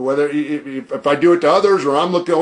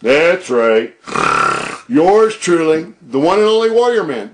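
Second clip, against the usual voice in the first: growled wrestler entrance, with a long drawn-out pause on "Yours truly"; trademark entry; 3 dB louder, slower than usual. Acted. Wrestler greeting his viewers with his trademark entry voice. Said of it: "That is my trademark entry right there".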